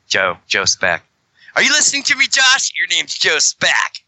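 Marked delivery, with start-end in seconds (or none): 1.6-4.0 mock scolding. Teasing his friend by nickname.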